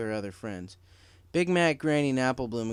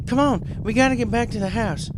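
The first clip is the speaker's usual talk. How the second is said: higher, louder hurry-up shout; urgent call; 11 semitones higher, 8 dB louder than usual. Acted. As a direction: get everyone moving. Urging during earthquake.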